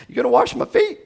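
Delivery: high, laughing disbelief; can't believe what the master is doing; incredulous Peter. Retold or imitated. Peter to Jesus, high laughing disbelief. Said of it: "Peter says".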